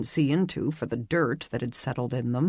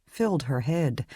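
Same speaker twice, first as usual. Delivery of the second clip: ordinary delivery, nothing marked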